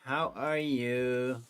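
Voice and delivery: usual speaking voice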